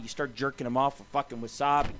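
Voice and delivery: ordinary talk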